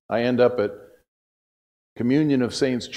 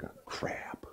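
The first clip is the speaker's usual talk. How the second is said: retold private thought, whispered; hushed oh-no aside; whispered dismay; 20 dB quieter than usual. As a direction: whisper it under your breath. Retold dread at being singled out.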